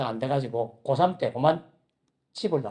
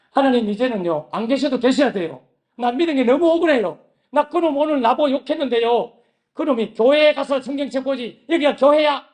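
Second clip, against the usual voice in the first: higher and louder, venting outrage; aggrieved angry prayer; 5 semitones higher, 5 dB louder than usual. Retold or imitated. Student complains to God about a junior who swore at him, loud and outraged. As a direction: pour out the grudge at full volume.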